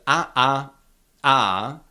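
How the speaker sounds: "a" as a full open ah each time, separately; three separate, equally full ah sounds for "a"; identical full vowels. Shown as a demonstration. Teacher isolating the vowels of kamarad, full identical "a". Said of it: "are always the same".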